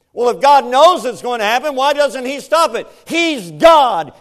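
loud, high, driving sermon voice; a loud, ringing pulpit delivery; full-force preaching. Acted. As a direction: preach it hard to the congregation.

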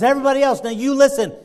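high, loud call for attention; prophet's commanding public proclamation; preaching to a crowd. Retold or imitated. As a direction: address the whole courtyard. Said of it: "preaches to the whole crowd".

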